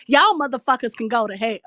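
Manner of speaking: pissed off, hitting "Y'all" hard; real anger. Real, not acted. Host telling off those who dragged someone, angry. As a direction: curse them out in earnest, heated. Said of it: "I'm just pissed right now".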